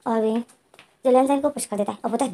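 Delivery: ordinary delivery, nothing marked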